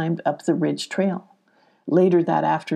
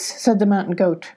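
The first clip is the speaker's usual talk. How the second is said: usual speaking voice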